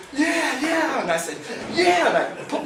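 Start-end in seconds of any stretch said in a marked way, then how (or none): 0.1-1.0 high, loud laughing yeah; joyful cheer. Retold or imitated. Celebrating landed fish. 1.7-2.1 high, loud laughing yeah; joyful cheer. Retold or imitated. Celebrating landed fish.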